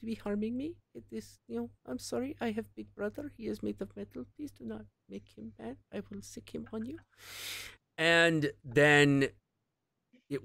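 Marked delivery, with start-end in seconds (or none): 0.0-7.0 high, soft, quick mock Russian girl accent; timid put-on Russian accent, warning; little Russian girl accent. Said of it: "Little Russian accent".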